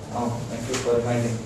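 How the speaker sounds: normal talking voice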